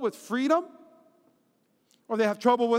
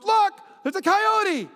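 high, loud, drawn-out 'look!'; imitated excited pointing; 10 semitones higher, 5 dB louder, slower than usual. Wife on speakerphone spotting a coyote, mock excited cry. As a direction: cry it out like a sighting, hammed up.

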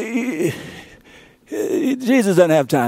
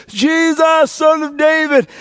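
crying out; 8 semitones higher than usual. Acted. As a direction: call out across the crowd. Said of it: "the voice that says".

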